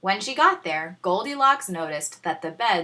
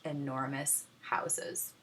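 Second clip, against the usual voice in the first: 1 dramatic whisper; 4 semitones lower, 12 dB quieter than usual. A narrator's reading. Narrator dramatic hush.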